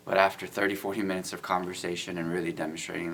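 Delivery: usual speaking voice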